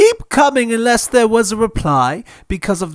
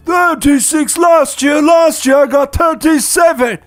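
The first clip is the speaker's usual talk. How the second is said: bratty shout; 8 semitones higher than usual. Acted. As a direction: yell it like a spoilt kid counting presents. Dudley whining about presents.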